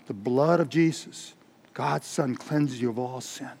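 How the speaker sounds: soft, low, slow reply; hushed, measured preacher's answer; calm assurance. Acted. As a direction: repeat the promise gently and unshaken. Finney's calm repeated answer.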